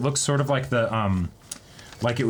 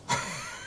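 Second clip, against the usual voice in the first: laugh; 9 dB quieter than usual. Real, not acted. After reading useless abilities.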